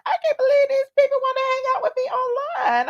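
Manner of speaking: fake-sob with joy; mock happy crying. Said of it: "I'm like".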